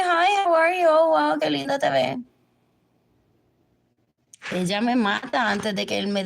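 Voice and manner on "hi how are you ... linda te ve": mock sweet greeting. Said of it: "And say".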